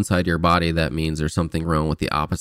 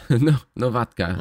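put-on Russian accent; 5 semitones higher than usual. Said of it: "best Russian accent".

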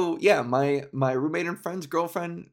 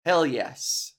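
normal talking voice

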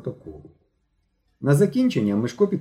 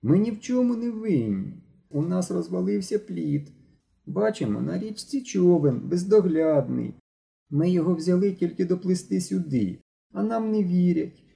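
high whining explanation; a scared boy whining his excuse; whimpering plea, much higher; 7 semitones higher than usual. Acted. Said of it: "захникав Ігор".

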